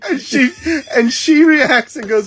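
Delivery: ordinary talk, nothing marked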